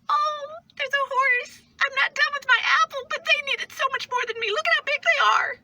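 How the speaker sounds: cartoonishly high put-on voice; put-on gushing voice. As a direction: gush in a silly high-pitched voice. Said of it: "I do those voices".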